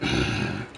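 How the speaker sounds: low soft sigh; weary sigh. Real, not acted. Tired of the earworm, a low sigh. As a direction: breathe out heavily.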